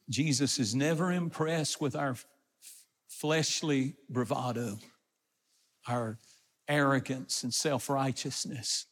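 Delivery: usual speaking voice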